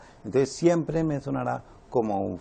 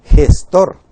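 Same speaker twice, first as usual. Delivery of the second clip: gestor called out very loud, rising at the end; teacher repeats gestor loudly for the class; loud model word; 14 dB louder than usual. Shown as a demonstration. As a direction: call out gestor loudly, with a rise. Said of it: "la palabra gestor".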